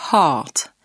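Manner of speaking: "heart" with a long open a and a nearly silent r; long open vowel in "heart"; English heart. Shown as a demonstration. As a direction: stretch the a in "heart", drop the r. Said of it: "Heart se prononce".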